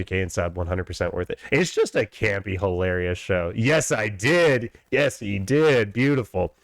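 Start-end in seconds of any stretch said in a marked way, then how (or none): none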